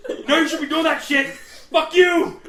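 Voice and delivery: loud yell at the ducks; shouting and laughing, cursing at ducks. Retold or imitated. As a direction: scream it out the boat at the birds. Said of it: "he's like".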